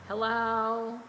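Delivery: lightly imitated Southern twang, drawn out; twangy Southern hello. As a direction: answer the phone in a mock twangy drawl. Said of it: "Y'all wanna hear what he sounded like?".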